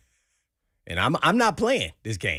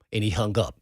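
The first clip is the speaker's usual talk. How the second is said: normal talking voice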